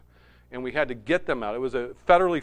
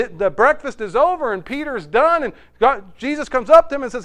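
high, loud sermon delivery; impassioned preaching; 10 semitones higher, 11 dB louder than usual. Acted. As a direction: preach it with force, leaning on key words. Preaches the fishing story.

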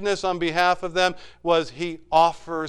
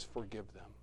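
hushed plea; 5 semitones lower, 17 dB quieter than usual. Acted. Jesus' words on the cross.

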